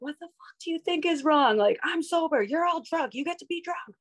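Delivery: retold angry rant at a friend; lashing out. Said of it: "I was lashing out at her".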